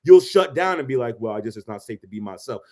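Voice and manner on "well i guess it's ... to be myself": soft, low, resigned quote; mock someone giving up quietly; meek shutting down. Imitating a man who shuts down around his partner, meek.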